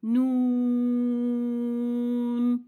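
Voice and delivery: long held nasal nun; drawn-out nun. Shown as a demonstration. Teacher demonstrating نون to students, long nasal hum. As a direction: stretch the letter نون with a humming n. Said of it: "یہاں تھوڑی کم لگے گی".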